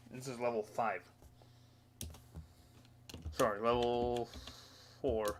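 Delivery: talking around a pencil in the mouth; pen in teeth. Real, not acted. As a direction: pencil clamped between teeth. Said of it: "Got my pencil in my mouth".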